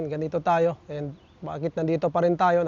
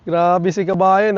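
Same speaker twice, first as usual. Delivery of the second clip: laughing it out slowly; 5 dB louder, slower than usual. Real, not acted. Vlogger laughing at a hater's comment, slow laugh. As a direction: chuckle through the words, amused and a bit stunned.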